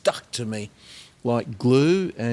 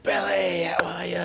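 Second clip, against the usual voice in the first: breathy greeting; 6 semitones higher than usual. Retold or imitated. Chris greeting him on arrival.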